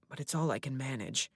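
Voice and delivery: ordinary delivery, nothing marked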